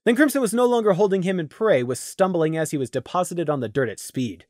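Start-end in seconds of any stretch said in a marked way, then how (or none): none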